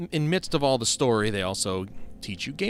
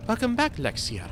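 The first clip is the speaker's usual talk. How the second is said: higher-pitched character voice; 10 semitones higher than usual. Acted. Game character greeting Lexia on return, higher-pitched voice. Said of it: "the attempt at the higher pitched voice".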